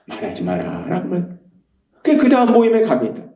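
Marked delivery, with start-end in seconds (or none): 0.0-0.9 low, hushed inner command; quiet, deep divine reply; Holy Spirit's voice. Retold or imitated. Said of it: "그럼 성령님이 또 말씀하세요".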